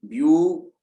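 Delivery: View drawn out long and loud; stressed syllable. Shown as a demonstration. Teacher showing a long sound, view held and stressed. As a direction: stretch the vowel of view, pushing it out. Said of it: "लंबा है".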